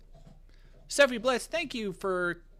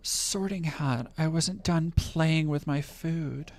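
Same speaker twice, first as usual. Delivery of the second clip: ASMR voice; 4 dB louder, much slower than usual. Pretend. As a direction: draw it out slow and intimate. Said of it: "a little A S M R".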